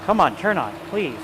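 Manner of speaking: pleading with a machine. Real, not acted. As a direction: urge the compressor on. Compressor won't start.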